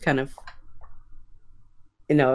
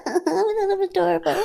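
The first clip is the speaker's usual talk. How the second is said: put-on very high laughing anime girl. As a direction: squeal it sweetly like anime. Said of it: "she can actually sound like an anime girl".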